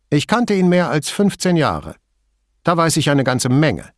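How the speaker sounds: terse middle-aged woman, wary; Signora Toso voice. A narrator's reading. Signora Toso's voice.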